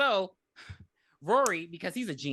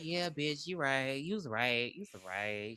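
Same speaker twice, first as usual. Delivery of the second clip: grudging admission; 5 semitones lower, 7 dB quieter, slower than usual. Acted. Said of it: "And so Emily like".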